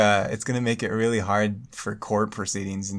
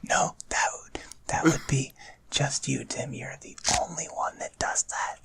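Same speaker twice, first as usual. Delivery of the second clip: breathy whispered reply, no voice; mock ASMR whisper; 3 semitones higher, 6 dB quieter than usual. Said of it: "A S M R episode and we were all whispering".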